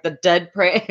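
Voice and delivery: ordinary talk, nothing marked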